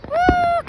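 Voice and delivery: high, loud woo; excited whoop. Real, not acted. Celebrating Canada's birthday, excited high whoop. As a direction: let out a loud, high cheer.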